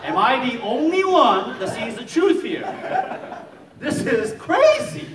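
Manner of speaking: high, loud mock exasperation; hammed-up, high-pitched shout of disbelief; exasperated outburst. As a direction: cry out as the only sane one in the room. Lone skeptic exasperated among believers.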